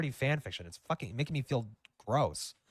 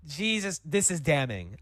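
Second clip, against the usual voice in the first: ordinary delivery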